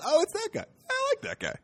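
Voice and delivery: high, bright sing-song oh it's that guy; mock delighted recognition. Comic watching [name] host SNL, squealing high recognition. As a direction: squeal it like spotting someone you know. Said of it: "that was my little reaction".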